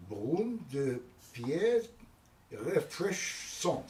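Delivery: French accent, slow and deliberate; French pronunciation. Shown as a demonstration. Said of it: "Or in French, it's".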